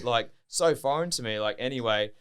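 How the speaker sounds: ordinary talk, nothing marked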